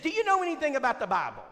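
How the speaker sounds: high falsetto woman; Brenda's voice. Retold or imitated. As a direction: address him in her voice. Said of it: "She said".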